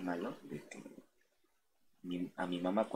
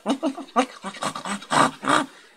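high, loud squealing pig noise; 11 dB louder than usual. Retold or imitated. Imitating the pig's squeal for the stream audience. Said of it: "hacía así el chancho".

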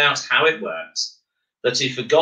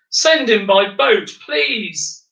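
much higher, louder wailing plea; 11 semitones higher, 3 dB louder, slower than usual. Acted. The Concorde pilot begs not to fly Miles, high wail. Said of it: "wailed the pilot of Concorde".

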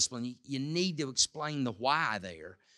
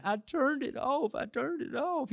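put-on whining child; 14 semitones higher than usual. Son protesting to dad about the light, imitated high whine. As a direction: mimic a crying boy protesting. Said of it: "he starts crying and whining".

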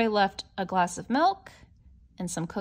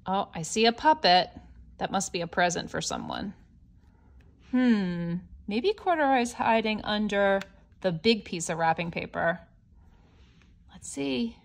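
plain reading voice

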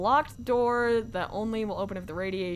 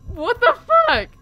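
shocked yell; 13 semitones higher, 9 dB louder than usual. Real, not acted. Surprised death in game.

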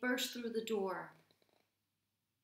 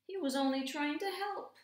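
high, louder tearful wail; weeping cat; 8 semitones higher, 6 dB louder than usual. A narrator's reading. Cat weeping for the lost duck. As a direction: cry it out like a heartbroken cat. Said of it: "wept the cat".

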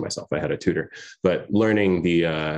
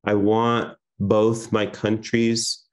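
poem reading; 3 semitones higher than usual. Acted. Poet reading her last poem to the audience, hushed recitation. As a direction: give every image its own breath.

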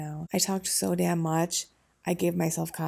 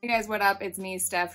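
hyped intro. Acted. Vlog intro greeting.

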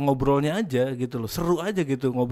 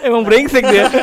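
laughing; 8 semitones higher, 8 dB louder than usual. Real, not acted. Tertawa saat bercanda.

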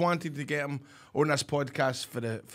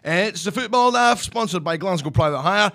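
higher-pitched radio ad read; 4 semitones higher than usual. Pretend. Co-host plugs the taxi sponsor on request, radio voice. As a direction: sell it like a radio presenter. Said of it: "do it in your radio voice".

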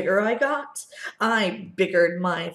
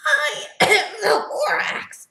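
high, loud Lorax voice; Lorax returning; 13 semitones higher, 5 dB louder than usual. Acted. Lorax confronting the Onceler again, high and loud. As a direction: announce yourself indignantly. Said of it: "Lorax came back with more gripes".

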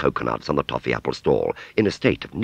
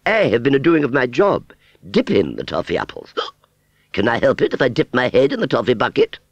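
high, loud, self-justifying protest; haughty; 9 semitones higher, 5 dB louder than usual. Acted. Aunt Sally excusing her toffee dipping, haughty. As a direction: defend yourself grandly, pitched up. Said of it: "said Aunt Sally haughtily".

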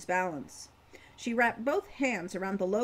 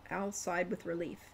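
ordinary delivery

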